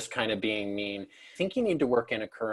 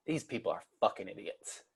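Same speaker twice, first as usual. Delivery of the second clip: softer, low aside to the side; a sneering aside muttered away from the crowd; 3 semitones lower, 10 dB quieter than usual. Shown as a demonstration. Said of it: "look off to the side, and go".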